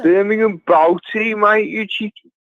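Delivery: hammed-up Birmingham accent; exaggerated Birmingham local; Brummie accent. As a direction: reel it off in thick Brummie. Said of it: "all Brummies sound like that".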